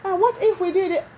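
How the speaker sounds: high, hesitant coaxing wife voice; timid pleading wife. Retold or imitated. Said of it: "I've given an example".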